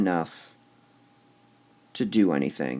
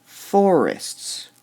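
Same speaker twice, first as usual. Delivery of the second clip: Forests with FOR punched high and loud; emphatic first syllable on Forests; stress on first syllable; 7 semitones higher, 5 dB louder than usual. Shown as a demonstration. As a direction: hit the FOR in Forests, then let it fall. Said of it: "we'd emphasize the for part".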